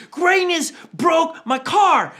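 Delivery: high, loud, slow tattle; whiny tattling. Retold or imitated. Boy complaining to mom that his brother broke the car.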